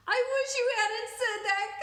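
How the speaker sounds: high, loud anguished wail; groaning cry. Acted. Camellia wailing at the therapist, high and loud. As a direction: cry it out in dismay. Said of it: "Camellia groaned".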